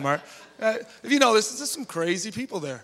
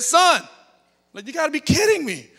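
disbelief; 11 semitones higher, 7 dB louder than usual. Retold or imitated. Retelling disbelief at the con.

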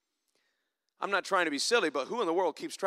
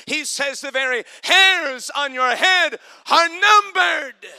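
preaching at full force; 6 semitones higher, 7 dB louder than usual. Acted. Preacher quoting Jesus to his congregation, shouted preaching. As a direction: climb to a shout on the verse.

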